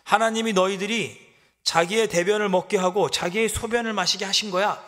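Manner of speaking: enemy shouting insults at Jerusalem; shouted taunt. Acted. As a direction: humiliate them with the insult. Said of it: "소리치면서".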